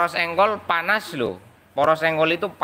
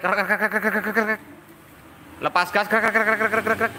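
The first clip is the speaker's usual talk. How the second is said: higher krek krek creaks; imitated creaking; 5 semitones higher than usual. Shown as a demonstration. Mechanic imitating the creak when pulling throttle. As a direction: grind out the creak like a loose part. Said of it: "Mau narik".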